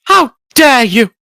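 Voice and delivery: hammed-up indignant potato; potato impression. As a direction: squeak it out slowly like a cartoon potato. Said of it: "With a potato impression that good".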